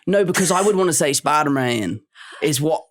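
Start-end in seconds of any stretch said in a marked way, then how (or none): 1.1-2.0 Southern drawl on "Spider-Man"; Deep South accent. Shown as a demonstration. British actor showing his slip, Southern accent. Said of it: "that is the Deep South".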